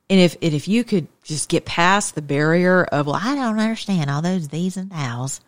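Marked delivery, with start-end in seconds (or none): 3.1-5.4 put-on Southern accent. Someone balking at Bible language, Southern accent. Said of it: "automatically go to a Southern accent".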